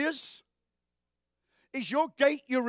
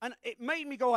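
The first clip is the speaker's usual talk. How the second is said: ordinary delivery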